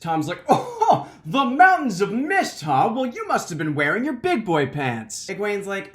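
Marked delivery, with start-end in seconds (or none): 0.5-5.3 high, loud mock-impressed laugh turning condescending; mock the boys with fake awe; making fun. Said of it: "I'm totally making fun of him".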